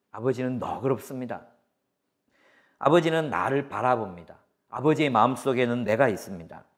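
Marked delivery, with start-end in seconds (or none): none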